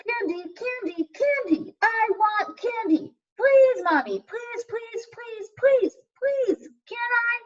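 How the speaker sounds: put-on high-pitched child pleading for candy; whiny begging kid. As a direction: beg like a little kid in a candy store.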